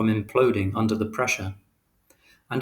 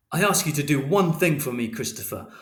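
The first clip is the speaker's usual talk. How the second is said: angry reproach; 5 semitones higher than usual. A narrator's reading. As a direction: hammer home the one thing. Father's angry final reproach.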